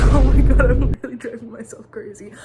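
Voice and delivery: bursts out laughing, then a loud exasperated oh my God; laughing in disbelief. Real, not acted. Laughing at her own sniffling habit, loud exasperated oh my God. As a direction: laugh it off, half frustrated with herself. Catches herself sniffling again.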